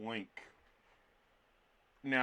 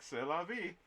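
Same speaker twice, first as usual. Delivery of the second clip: mock French shrug; 6 semitones higher, 4 dB quieter than usual. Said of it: "I'd just be like".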